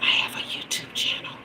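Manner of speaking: breathy secret whisper, no voice at all. Retold or imitated. Whispering to the store clerk about her channel. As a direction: hush it like a confession. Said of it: "I whispered to him".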